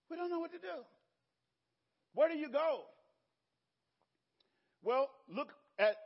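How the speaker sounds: high, loud pulpit voice; impassioned preaching. Real, not acted. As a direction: press the congregation with ringing questions. Preaches at full force.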